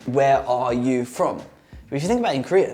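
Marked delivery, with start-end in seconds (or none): 0.1-1.4 "Where are you from?" spaced out slowly, word by word; slow full question. Shown as a demonstration. Spelling out what the stranger meant, slow deliberate question.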